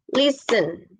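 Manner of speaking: teacher's demo of LISten; stress on first syllable. Shown as a demonstration. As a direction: hit the start of listen, let the end drop. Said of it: "como que es más alto".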